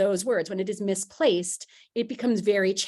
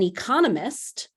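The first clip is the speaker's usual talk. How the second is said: economist said as e-CON-omist; second-syllable stress. Shown as a demonstration. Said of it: "has the stress on that second syllable".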